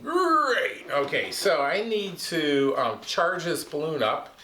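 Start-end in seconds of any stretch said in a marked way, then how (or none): 0.0-0.8 high bright puppet answer; cheery puppet. Acted. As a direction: chirp it.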